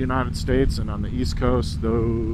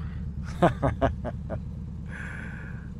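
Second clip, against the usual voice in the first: breathy laugh; 8 dB quieter than usual. Real, not acted. Laughs at own Spanish.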